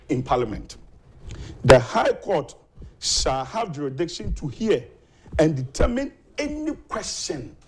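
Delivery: deliberate, emphatic reading of a legal clause; reading the law aloud. Real, not acted. Guest reads the constitution to the host, deliberate and emphatic. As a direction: read it out in weighty chunks, pausing between phrases.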